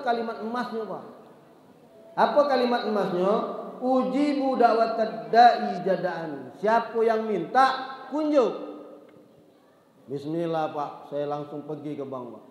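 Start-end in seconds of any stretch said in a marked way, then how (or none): 3.8-8.7 verse drawn out slowly, then kunjuk high; slow verse, then a sudden high kunjuk; drawn-out recitation ending on a punched kunjuk. Acted.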